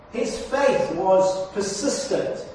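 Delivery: ordinary delivery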